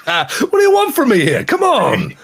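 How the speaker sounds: high, loud, laughing protest; mock exasperation. Host defending his bad puns to co-hosts, mock exasperated. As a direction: plead in playful indignation, voice shooting up.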